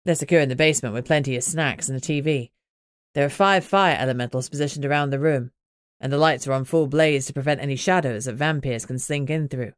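calm mate reporting the pups are safe; Greg's voice. Acted.

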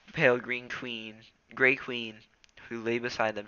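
usual speaking voice

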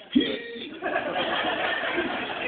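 a strained, breathy gasp as if forcing tears; high mock gasp. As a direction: fake a sob that won't come. Mimics Kim trying to cry.

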